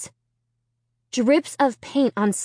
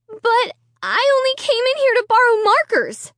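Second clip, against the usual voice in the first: high, shaky pleading; trembling voice; 4 dB louder than usual. A narrator's reading. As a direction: voice trembling near tears. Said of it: "I cried in a trembling voice".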